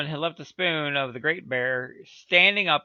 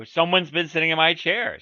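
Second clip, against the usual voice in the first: louder middle-sized bear complaint; lightly voiced middle bear, loud; middle bear's middle voice; 3 semitones higher, 7 dB louder than usual. A narrator's reading. Middle bear finds his chair sat in, middle voice. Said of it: "in his middle voice".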